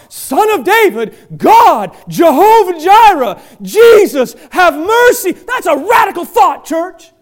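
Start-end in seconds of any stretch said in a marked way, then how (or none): 0.1-5.3 loud, slow plea; crying out to God. Shown as a demonstration. A pastor crying out to God for mercy, loud and slow. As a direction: cry out with everything. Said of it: "taught us to cry out".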